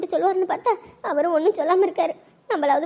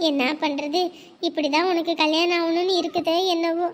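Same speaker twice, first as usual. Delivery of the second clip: ordinary delivery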